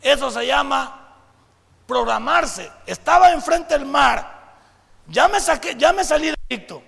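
loud, slow, stirring preacher delivery; preaching at full force. Acted. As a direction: preach it big, building to a shout. (predicando con toda la fuerza, en voz alta)

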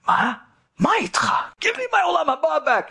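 high, urgent demand; desperate to get it back; frantic plea. Acted. Driver pleading for his contract.

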